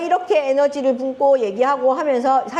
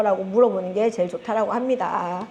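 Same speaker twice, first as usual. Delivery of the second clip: normal talking voice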